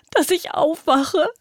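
very high, strained pleading; terrified begging. Acted. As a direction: beg in panic, close to tears. Terrified girl begs, near breaking.

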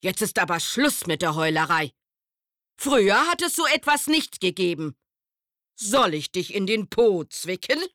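schnell und laut, alter strenger Käfer. Acted. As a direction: schimpf wie ein alter Griesgram. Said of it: "und sagte streng". (strenger alter Hirschkäfer, laut und schnell)

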